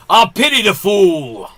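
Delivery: loud gruff tough-guy growl; Mister T impression. Host doing Mister T for a guessing game, loud tough-guy bark. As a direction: bark it like the A-Team bruiser. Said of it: "Mister T".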